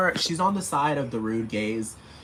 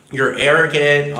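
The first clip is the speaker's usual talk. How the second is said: louder and slow, hammering it home; loud accusation; 3 semitones higher, 8 dB louder than usual. Real, not acted. Ranting at Simon Cowell, slow loud accusation. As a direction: spit it out slowly, louder. Angry rant insult.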